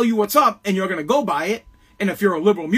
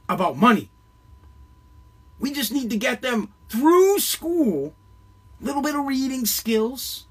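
paranoid prepper warning; 3 semitones higher than usual. Retold or imitated. Mocking the government's voice.

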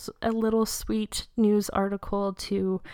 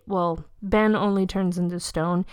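ordinary talk, nothing marked

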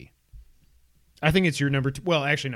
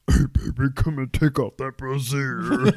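Robert/character; 4 dB louder, slower than usual. Retold or imitated. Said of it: "he's like".

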